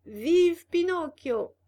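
high, loud, drawn out slowly; cheering. A narrator's reading. Boys in the cart cheering Pinocchio, loud. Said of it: "commencèrent à hurler".

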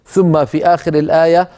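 normal talking voice

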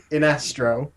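Inestro said as in-ESS-troh; short I, stress the NES in Inestro; pronunciation challenge. Shown as a demonstration. Podcaster questioning the rule, Inestro as in-ESS-troh. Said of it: "Has to be pronounced".

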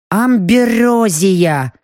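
slow, awed elf voice; reverent elf. Acted. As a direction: stretch the word out in awe. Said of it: "наконец сказал эльф".

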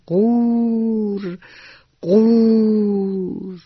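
slow, drawn-out high croaking; frog croak. Acted. Narrator croaks like the frog to the children. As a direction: croak it slowly like a little frog.

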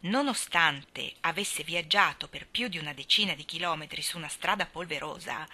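ordinary delivery, nothing marked

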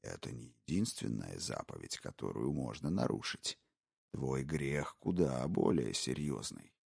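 low, hushed, gloating whisper; a predator savouring every word; menacing intruder. Acted. Intruder taunting the wife, low sinister whisper. Brogan's voice.

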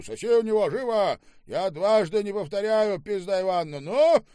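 very high, loud shouted order; furious threat. Acted. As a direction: shout with mounting menace to the last word. Штаубе threatens with a bottle. (бешеный крик с угрозой)

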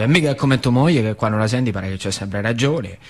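ordinary delivery

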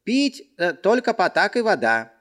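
high, eager servant voice; fawning tavern boy; obsequious servant. Acted. Servant lists drinks.

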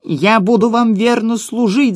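high, slow gnome voice crying out; pleading gnome cry. A narrator's reading. Cowardly gnome swears loyalty to his captors, crying out. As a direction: cry out high, begging to serve. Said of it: "закричал гном".

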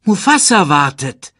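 high, loud call. A narrator's reading. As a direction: call him on urgently. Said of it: "rief Rafiki". (laut und drängend rufen)

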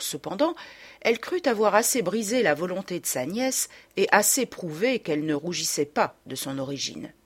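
ordinary delivery

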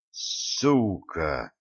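hissed with hatred; hateful hiss. Acted. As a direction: spit it out low. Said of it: "с ненавистью прошипел". (с ненавистью прошипеть)

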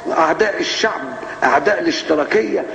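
mock prosecutor speech. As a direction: parody a prosecutor denouncing enemies of the people. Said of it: "ويجي بقى وكيل النيابه واقف".

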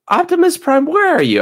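calling out to a missing friend. Real, not acted. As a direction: shout for Optimus Prime to answer. Calling out to absent cohost.

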